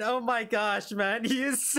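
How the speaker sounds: normal talking voice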